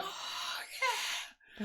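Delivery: high, hushed mock scream; retold excited scream. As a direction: squeal it softly, reliving the thrill. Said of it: "screaming and laughing".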